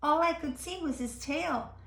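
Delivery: ordinary delivery, nothing marked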